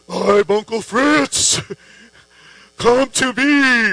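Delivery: slow, eerie, put-on ghost luring you in. A pretend ghost luring him into the lake, slow and spooky. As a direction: draw the words out like a spooky ghost. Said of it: "the ghost would be like".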